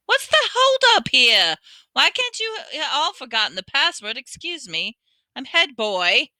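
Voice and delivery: high, fussy self-important voice; Percy pushing to the front, pompous and fussy; pompous head boy. A narrator's reading. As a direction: puff up with authority.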